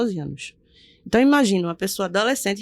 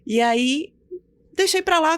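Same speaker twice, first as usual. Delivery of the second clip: ordinary delivery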